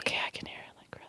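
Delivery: very faint unvoiced whisper; testing a tiny whisper on the mic. Shown as a demonstration. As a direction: whisper it, trailing away to nearly nothing. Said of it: "try whispering really quietly".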